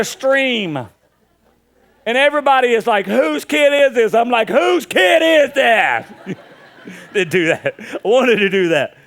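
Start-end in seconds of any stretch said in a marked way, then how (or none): 3.1-4.1 loud, high and drawn-out disbelief; mock outrage. Onlookers asking about the peeing boy, loud mock shock. Acting out the shocked pool crowd. 4.5-6.1 louder, very slow, high mock disbelief; feigned shock. Dad disowning his son before the crowd, exaggerated loud shock.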